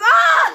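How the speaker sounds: a high, loud shriek of laughter. Real, not acted. Retelling the deputy principal showing up, shrieking laugh. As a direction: burst out laughing at the disaster.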